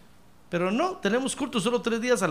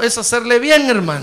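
call to repeat; 9 dB louder than usual. Shown as a demonstration. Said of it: "dígale que tiene a un lado".

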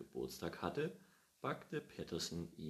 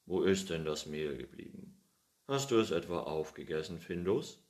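put-on louder old man calling from the pantry; 8 dB louder than usual. Pettersson calling Findus from the pantry, louder. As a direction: call to the cat from the next room. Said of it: "rief er aus der Speisekammer".

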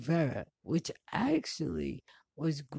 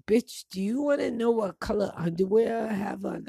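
loud, fast, fed-up snap; angry retort; 5 semitones higher, 6 dB louder, faster than usual. Retold or imitated. Annoyed traveler snapping loudly at security. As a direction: throw the question back at the airport agent. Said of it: "I started getting angry".

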